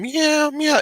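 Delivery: high pitched cat meows; cat meowing. Retold or imitated. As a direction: imitate a small cat mewing. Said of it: "you hear the cat going".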